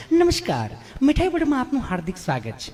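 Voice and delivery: sing-song recorded operator; imitating a phone menu recording; IVR menu voice.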